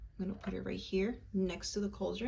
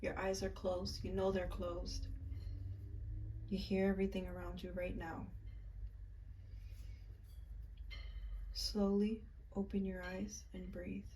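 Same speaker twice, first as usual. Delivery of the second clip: guided meditation; 9 dB quieter than usual. Acted. Guiding viewers through a ritual visualization, soft and calm.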